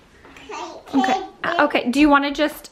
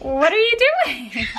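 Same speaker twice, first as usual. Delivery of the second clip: laughing question; 10 semitones higher than usual. Real, not acted. Mom laughing at toddler.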